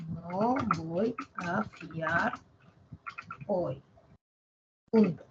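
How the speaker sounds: slow dictation. Shown as a demonstration. Teacher dictating the sentence to the class, slowly. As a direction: spell it out word by word as you type. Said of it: "voy a escribir mi oración".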